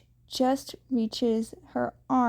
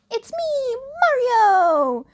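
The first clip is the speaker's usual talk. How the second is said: much higher, loud chirpy cry; chirpy exclaim; 13 semitones higher, 7 dB louder, slower than usual. A narrator's reading. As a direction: bounce it out like a game hero. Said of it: "chirpedly exclaims".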